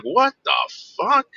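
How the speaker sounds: retold stunned outburst; retelling his shock at the crash.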